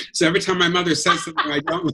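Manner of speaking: normal talking voice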